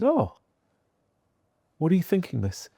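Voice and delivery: put-on a boy baffled by the suggestion; puzzled young self. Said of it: "And I said".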